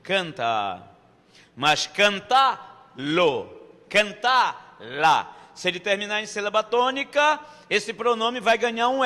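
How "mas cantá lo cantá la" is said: "cantá-lo, cantá-la" drawn out slowly with the L sounded clearly; slow model pronunciation. Shown as a demonstration. Teacher to class, modelling the L forms slowly and clearly. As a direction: spell out "cantá-lo" and "cantá-la" syllable by syllable, slowly. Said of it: "Canta-o".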